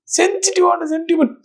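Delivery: high-pitched fake weepy quote; mock crying. Reactor teasing the leader about crying, mock weepy. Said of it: "அழுவு வருதா?".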